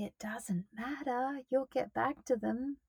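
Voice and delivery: softly whispered reassurance; gentle whispered aside. Real, not acted. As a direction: breathe it as a whisper.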